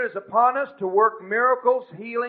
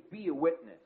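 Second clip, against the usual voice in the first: "be" stressed, the rest softer; 3 semitones lower, 9 dB quieter than usual. Shown as a demonstration. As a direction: lean on the word "be". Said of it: "put the emphasis on the word be".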